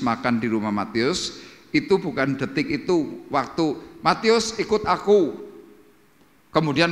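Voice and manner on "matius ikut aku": higher, raised acted call to follow; acted summons, pitched up; calling a disciple. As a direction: call him over, voice lifted. Acting Jesus calling Matius.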